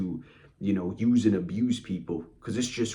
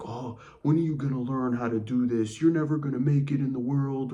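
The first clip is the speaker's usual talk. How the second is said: higher, lightly mocking scolding; mock belittling speech; 5 semitones higher than usual. Control freak making you feel incompetent, mock belittling. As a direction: imitate someone putting you down. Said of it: "give you some speech like".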